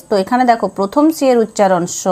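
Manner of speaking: normal talking voice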